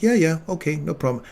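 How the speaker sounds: bright and unaware, lightly voiced; mother unaware of what happens in the ambulance, breezy; clueless mom. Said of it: "She's like".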